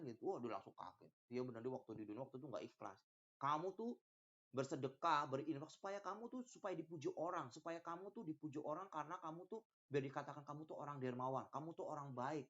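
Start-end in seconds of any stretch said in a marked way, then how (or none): none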